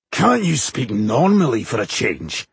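gruff dwarf's growl. A narrator's reading. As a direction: growl it in irritation, without shouting. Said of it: "growled Bowindil".

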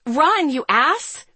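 high, drawn-out urgent shout. A narrator's reading. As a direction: yell it slowly and high. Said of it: "I shouted".

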